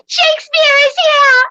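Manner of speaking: high, loud excited gasp. Acted. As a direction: gasp and announce it breathlessly.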